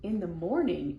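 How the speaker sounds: usual speaking voice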